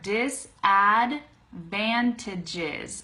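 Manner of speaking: very slow, part by part; slow teacher's model, each part apart; slow syllable breakdown. Shown as a demonstration. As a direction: break the word into separate syllables. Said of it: "that's the first part".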